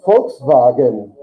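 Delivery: "Volkswagen" with F for V and V for W; German pronunciation. Shown as a demonstration. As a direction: slowly say "Volkswagen" as "folks-vagen". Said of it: "That's the way Germans say this".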